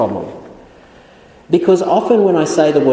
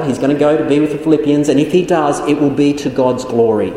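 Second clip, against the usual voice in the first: normal talking voice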